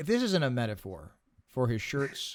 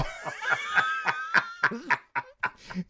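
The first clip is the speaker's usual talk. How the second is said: shrieking burst of laughter; high shrieking laugh. Real, not acted. Laughing at the eighties joke with a high shriek. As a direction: let out a high cackle.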